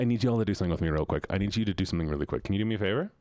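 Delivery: rushed and quick; fast. Real, not acted. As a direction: rattle it off in a hurry. Rushed request to chat.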